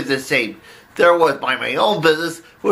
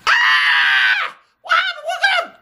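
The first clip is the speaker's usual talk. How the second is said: panicked scream; slower than usual. Acted.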